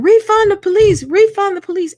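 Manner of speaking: high, loud mock scream of "Refund the police"; loud high imitation of a protester's scream; imitating a scream. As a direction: shriek it like the protester. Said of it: "she's screaming".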